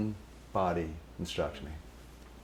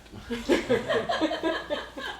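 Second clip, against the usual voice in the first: high laugh. Retold or imitated. Guru laughs at him.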